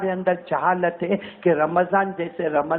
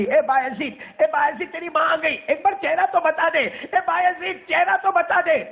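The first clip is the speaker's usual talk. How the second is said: mother calling out; 11 semitones higher than usual. Retold or imitated. Mother cries out after her departing son for one look. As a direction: beg him to show his face.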